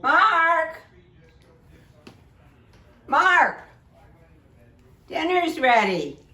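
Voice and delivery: loud, high call across the house. Real, not acted. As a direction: call him to dinner. Calling him to dinner.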